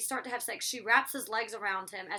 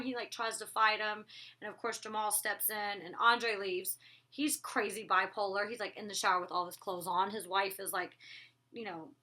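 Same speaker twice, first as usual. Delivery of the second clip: usual speaking voice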